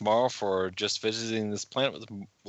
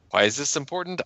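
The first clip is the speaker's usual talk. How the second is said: ordinary delivery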